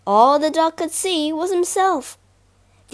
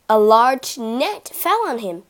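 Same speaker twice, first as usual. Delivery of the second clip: usual speaking voice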